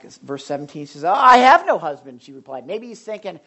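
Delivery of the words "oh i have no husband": higher and louder, quick denial; defensive retort. Retold or imitated. The woman brushing off Jesus, high and loud. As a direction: bat the question away.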